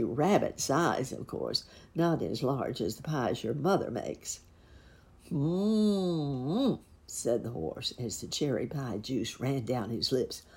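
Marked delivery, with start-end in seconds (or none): 5.3-6.8 long, appreciative mmm; drawn-out yummy hum, a little high; savoring hum. A narrator's reading. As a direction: hum with relish over a tasty bite. Horse savoring cherry pie.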